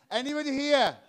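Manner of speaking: loud call with a laugh. Real, not acted. Comic calling out to the audience, laughing. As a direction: shout to the room, laughing.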